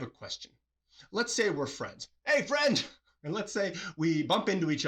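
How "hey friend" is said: bright call, much higher and louder; happy hello across the street; cheery greeting. Pretend. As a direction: call out to a friend you just spotted.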